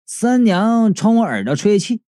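ordinary delivery, nothing marked

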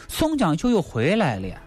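usual speaking voice

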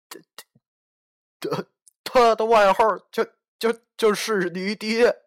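stuttering, shaky terrified reply; trembling stammer. Acted. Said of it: "他颤抖地说".

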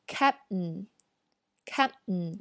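very slow captain, P and T held, not released; captain with both stops held, drawn out. Shown as a demonstration. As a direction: stretch captain, no bursts on P or T. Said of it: "所以这个词就会变成".

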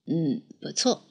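低沉轻声的满意嗯; 低声点头称赞; low approving coach. Acted. (教练低沉地说不错)

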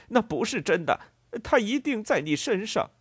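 loud high-pitched disbelief; agitated accusation. A narrator's reading. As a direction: insist frantically that it's a lie. Farmer disputes loss of the money. (激动地高声否认)

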